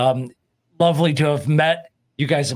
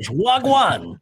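higher-pitched Jamaican "Wagwan"; Jamaican-style hello, pitched up; Jamaican patois greeting; 5 semitones higher than usual. Shown as a demonstration. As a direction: show them the Jamaican patois word. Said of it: "It's patois".

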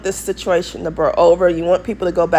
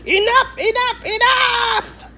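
very high mock cry, last word drawn out; vampire queen; 5 dB quieter, slower than usual. Mimicking the queen refusing the vampires, high theatrical cry.

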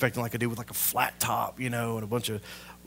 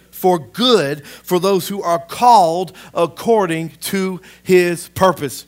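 loud, slow, high-pitched proclamation; forceful scripture reading; 6 semitones higher, 10 dB louder, slower than usual. Real, not acted. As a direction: preach the verse out, stretching the key words. Reads scripture aloud with force.

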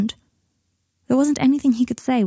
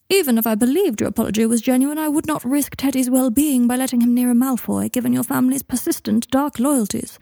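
higher, mocking imitation of a prim speaker; high mimicking voice; 5 semitones higher than usual. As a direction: mimic her refusal in a put-on high voice. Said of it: "Malfoy put on a high voice".

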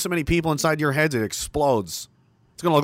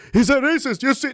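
high, loud whiny objector imitation; squealed mock accusation; mock outrage; 12 semitones higher, 4 dB louder than usual. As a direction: mimic an indignant accuser in a high voice. Mimics objectors crying racist.

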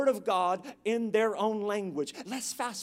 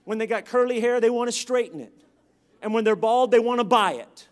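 loud punchline preaching, building louder; loud, punchy pulpit delivery; full-force preaching; 8 dB louder than usual. Real, not acted. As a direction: belt out each line to the congregation.

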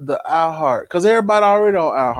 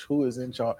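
softer, lower, trailing away; fading repeat; 5 semitones lower, 9 dB quieter than usual. Acted. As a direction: let it fade out.

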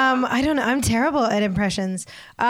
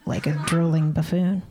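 ordinary talk, nothing marked

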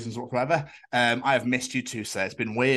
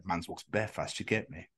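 mock whisper; 5 semitones lower, 9 dB quieter than usual. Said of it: "it was just whispered".

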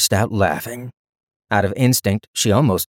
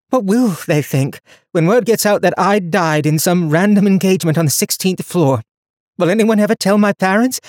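lightly voiced young heroine, higher; young woman voice; 9 semitones higher, 4 dB louder than usual. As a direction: speak her lines higher and brighter. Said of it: "Donia let her voice ramble".

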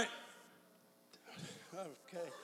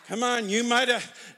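ordinary delivery

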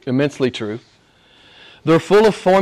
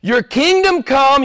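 much higher, crying out; fervent praise; 11 semitones higher, 4 dB louder, slower than usual. Acted. Preacher voicing worship to God, exultant. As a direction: exult in the words. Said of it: "what's the next thing he says".

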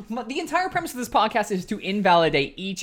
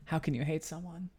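smug kill line; 3 semitones lower, 9 dB quieter than usual. Retold or imitated. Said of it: "the kill line".

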